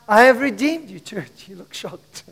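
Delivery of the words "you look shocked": ordinary talk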